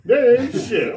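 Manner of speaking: hammed-up bitter rant about women; mock rant.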